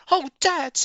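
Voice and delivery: a cub bursting with news; excited cry. A narrator's reading. Small fox crying out to Dad. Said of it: "he cried out".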